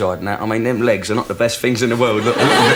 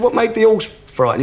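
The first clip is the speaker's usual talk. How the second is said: mock alarmed owner; 10 semitones higher than usual. Joke: owner's rising alarm.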